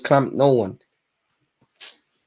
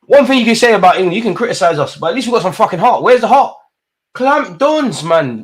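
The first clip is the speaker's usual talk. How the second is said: louder, quick and fired up; passionate rant; 5 semitones higher, 6 dB louder than usual. Real, not acted. Fan ranting at the team about heart, loud and fast. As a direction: rant hard about the team's fight.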